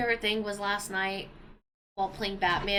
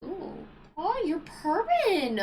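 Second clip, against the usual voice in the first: cooing at the cat; 10 semitones higher, 3 dB louder, much slower than usual. Real, not acted.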